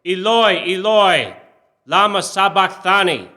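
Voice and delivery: high, loud anguished cry; a dying man calling out to God, loud. A narrator's reading. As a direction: cry it out with all the breath left. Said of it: "cried out in a loud voice".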